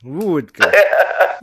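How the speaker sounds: vodka said as wudka, with a W and an OO; lightly Russian vodka. Shown as a demonstration. A friend asked how vodka is said in Russian, answered in mock Russian. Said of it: "teach me how to say vodka in Russian".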